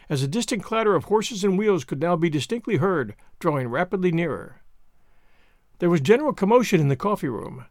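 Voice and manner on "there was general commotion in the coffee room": plain reading voice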